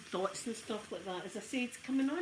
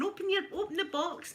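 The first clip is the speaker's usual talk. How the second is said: excited mum; 9 semitones higher than usual. Retold or imitated. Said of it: "and that are going".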